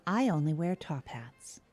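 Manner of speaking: ordinary talk, nothing marked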